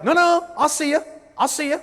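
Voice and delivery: high, loud, chirpy insistence; visual communicator. Shown as a demonstration. Trainer mimicking visual person signing off an email, high visual communicator. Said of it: "they talk like this".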